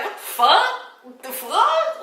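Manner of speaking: high, baffled double take; stunned questioning, pitched well up; retold disbelief. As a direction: ask it high, as if just hung up on. Parents hung up on her.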